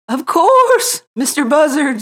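high, loud owl voice; wise owl voice. A narrator's reading. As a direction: hoot it out as the old owl. Owl welcomes the vulture.

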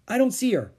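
anxious exclamation. Acted. Annie searching for the mouse, anxious.

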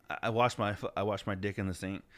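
mock-sheepish, low and small; sheepish confession. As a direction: own up quietly, tailing down. Retelling his sheepish confession.

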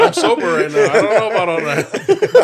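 high, loud, laughing protest; real laughing disclaimer. Real, not acted. Sober friend declining to join the instigator, laughing. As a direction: back out while cracking up.

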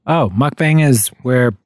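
ordinary delivery

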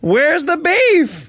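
put-on high, very slow drawn-out old woman; slow old lady catchphrase. Shown as a demonstration. Director demonstrating the catchphrase to the actor, high and drawn out. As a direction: stretch each word like a crotchety granny.

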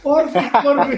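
put-on high, loud whiny begging like a little kid; mock child pleading. Father copying his son's begging back at him, whiny and high. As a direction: beg sweetly the way his son does. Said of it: "yo también me pongo en modo".

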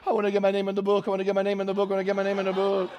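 very fast monotone run, repeated without a breath; frantic pleading. Retold or imitated. Crying woman begging at the altar, frantic rapid pleading. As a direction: rattle it off on one note, imitating her.

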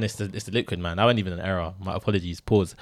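ordinary talk

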